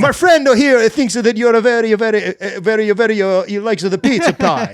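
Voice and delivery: broken English with a mock Italian lilt; fake Italian accent. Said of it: "like, fake Italian".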